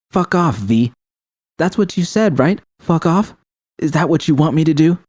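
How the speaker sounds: shouting. A narrator's reading. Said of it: "Judy shouted at her".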